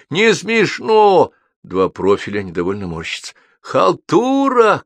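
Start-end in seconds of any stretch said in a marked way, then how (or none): 0.1-1.3 long, high, loud heckle; disapproving shout. A narrator's reading. Audience heckles the joker from all around, disapproving shout. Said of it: "свист, крики". 3.6-4.8 high, loud, stretched heckle; shouted jeer. A narrator's reading. Spectators heckle from some roof, shouted jeer. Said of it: "кричат с какой-то крыши".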